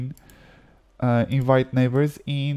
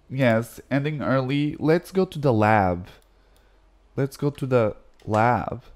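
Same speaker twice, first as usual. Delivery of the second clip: normal talking voice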